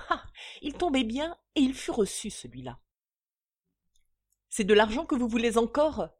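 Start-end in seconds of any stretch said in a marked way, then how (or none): 4.5-6.1 loud, furious demand, stressing que; fed-up creditor yelling at the count; angry outburst. Acted. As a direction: shout it at the debtor. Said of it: "clama Bonnaire".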